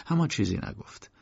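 usual speaking voice

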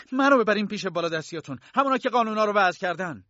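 loud, high-pitched shouted demand; angry demand. Acted. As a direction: shout insistently at the guard. K lunges at Franz, demanding superiors.

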